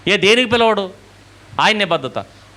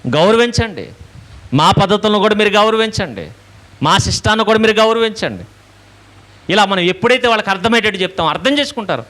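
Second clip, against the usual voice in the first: preaching at full force; 4 semitones higher than usual. Acted. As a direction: hammer each command home. Preacher exhorts: respect us.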